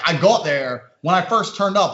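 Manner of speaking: normal talking voice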